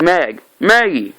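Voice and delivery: high cry of a name; acted calling out. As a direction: imitate a desperate call to a loved one. Dying man calls out to Maggie.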